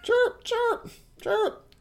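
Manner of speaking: very high shrill bird chirps; shrill screeching bird woman. Acted. Voicing a bird.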